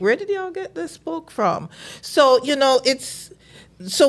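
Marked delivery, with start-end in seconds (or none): 0.0-1.7 surprised. Retold or imitated. Amazed at the obscure find.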